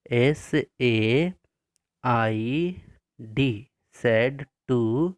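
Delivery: slow, spaced-out letters for copying; slow dictation spelling. Shown as a demonstration. Teacher dictating spelling slowly to pupils. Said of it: "लिखेंगे आप".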